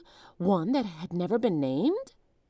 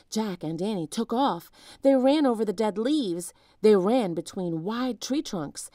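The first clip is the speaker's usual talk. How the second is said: ordinary delivery, nothing marked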